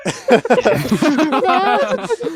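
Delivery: put-on high sweet little boy voice; Tiny Tim voice. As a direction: squeak like a frail Victorian boy. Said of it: "And you're like Tiny Tim".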